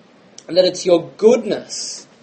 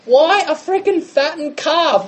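put-on indignant older brother; 6 semitones higher than usual. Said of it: "He goes".

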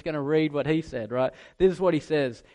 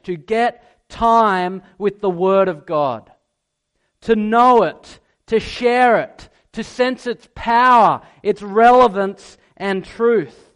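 louder, slower, building preacher's appeal; rising exhortation; 3 semitones higher, 7 dB louder than usual. Acted. Preacher reading Lennox's appeal to young people, loudly building. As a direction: drive the call home to young people.

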